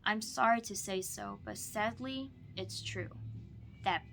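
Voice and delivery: lively, expressive storybook read-aloud; bouncy rhyming picture-book voice; reading to children. Acted. Teacher reads Seuss to student.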